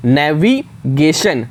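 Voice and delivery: Navigation said slowly so each letter comes out. Shown as a demonstration. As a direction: carefully sound out navigation. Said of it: "हर एक letter निकल करके आए".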